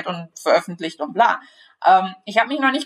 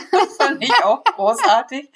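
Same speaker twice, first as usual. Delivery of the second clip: laughing through the words, pitched up; giggly, amused delivery; 5 semitones higher than usual. Real, not acted. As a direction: crack up while speaking. Amused by own favourite silly sentence.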